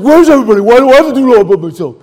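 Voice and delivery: self-mocking furious grumble; angry complaint. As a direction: rant like a sulking elder brother. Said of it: "I was like the elder brother".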